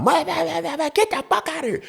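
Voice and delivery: very high mock screech; imitated angry female tirade. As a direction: babble and snap in a put-on woman's voice.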